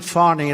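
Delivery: "Fani" as FAH-nee, lightly French; a little French accent. Shown as a demonstration. Speaker showing the crowd the name, "Fani" as French-tinged FAH-nee. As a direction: give "Fani" an open French ah. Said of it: "a little French accent there".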